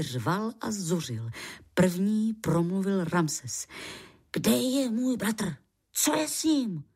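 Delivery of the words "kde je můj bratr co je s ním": higher, urgent questioning without much added volume; mild cry out. A narrator's reading. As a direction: demand answers in a raised pitch. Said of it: "vykřikl". (tlumený výkřik)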